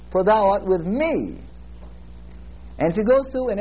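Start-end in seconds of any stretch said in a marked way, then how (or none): 0.1-1.4 "me" lifted and drawn out at the end; stress on me. Shown as a demonstration. Preacher finishing the word-by-word demo, stress on "me". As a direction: slowly, build to a long, falling "me". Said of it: "emphasize a word at a time".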